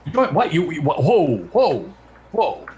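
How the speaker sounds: high, loud shocked whoa; shocked disbelief. Real, not acted. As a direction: react in total disbelief. Shocked friend joined gym.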